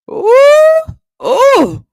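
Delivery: high squealing ooh of shock; shrieking ooh. Real, not acted. Host roasting a photo, shrieking ooh. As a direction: shriek it out in delighted horror.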